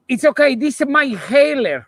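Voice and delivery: put-on high, loud Argentinian accent. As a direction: play Maradona with a thick Argentinian accent. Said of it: "when you do the Argentinian accent".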